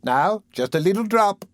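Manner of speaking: high, coaxing nurse tempting him; persuasive coaxing. A narrator's reading. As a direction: wheedle him into it. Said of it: "said Missus Mann persuasively".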